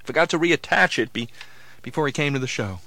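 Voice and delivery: ordinary talk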